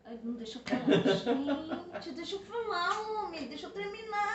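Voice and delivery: high, laughing plea to be allowed to finish; laughing protest. Real, not acted. Wife laughing as husband interrupts, pleading to finish. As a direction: giggle through it while insisting on your turn. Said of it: "Fala aí".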